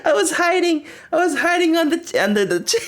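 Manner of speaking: high, breathless laugh-talk. Real, not acted. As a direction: giggle through the words, hiding under the chair. Laughing at own photo.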